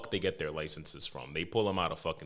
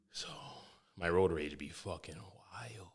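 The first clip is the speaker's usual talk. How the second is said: whispered aside; 2 semitones lower, 7 dB quieter than usual. Real, not acted. Confessional aside after story.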